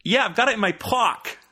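high-pitched mock Australian kid, vowel overdone on "pock"; bad Australian accent. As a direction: imitate a boy's botched Australian accent. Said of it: "worst attempt at an Australian vowel".

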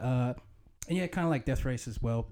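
ordinary talk

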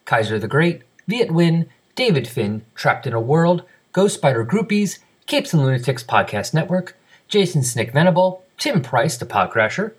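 brisk, emphatic podcast announcer delivery; scripted announcer read. Acted. Host reads feedback and listener names to the audience, announcer style. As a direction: read it out crisply with swinging, sing-song list intonation.

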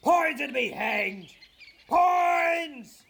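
high, loud angry calling. Acted. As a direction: bellow the name across the hill. Falstaff calling for lost friend.

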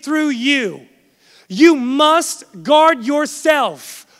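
deliberate at first, building to emphatic, punched delivery; forceful preaching. Real, not acted. Preacher urging the congregation to guard their hearts, building in force. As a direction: drive each charge home to the congregation.

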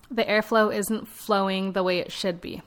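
normal talking voice

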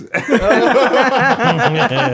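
loud high cackle of real laughter; loud cackling laugh. Real, not acted. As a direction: burst out laughing. Laughing at his own joke.

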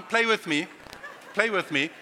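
ordinary talk, nothing marked

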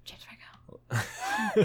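high whispered swoon; breathy whisper. Pretend. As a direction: whisper his name dreamily. Swooning over the actor.

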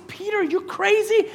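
mock incredulous scolding; incredulous rebuke. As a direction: question a friend's sanity. Jesus acted out rebuking Peter.

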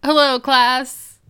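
loud, slow call to the class; drawn-out, hammed-up classroom hello; mock teacher greeting. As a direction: greet them like a playful schoolteacher.

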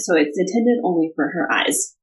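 usual speaking voice

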